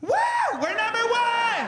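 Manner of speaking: high, loud sports yell; stadium cheer. Retold or imitated. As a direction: cheer your team like a fan. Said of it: "You'll never see your buddy like".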